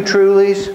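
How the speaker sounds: stressed "trulies"; emphatic trulies. Shown as a demonstration. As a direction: lean hard on "trulies".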